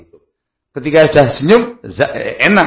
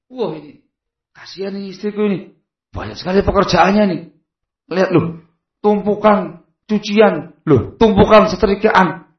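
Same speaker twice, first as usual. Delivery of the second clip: higher, rising fuss over the chores; concerned husband; 6 semitones higher than usual. Retold or imitated. Husband noticing his wife's piled-up chores, higher and rising. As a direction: fret aloud as you look around the house.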